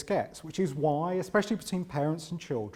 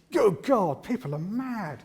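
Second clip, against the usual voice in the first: high-pitched mock-appalled outburst; raised-pitch feigned shock; mock disbelief; 6 semitones higher, 5 dB louder, slower than usual. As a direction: exclaim it in comic astonishment. Acted outburst at people's folly.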